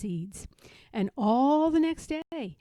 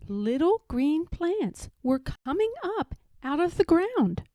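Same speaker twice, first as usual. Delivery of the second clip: plain reading voice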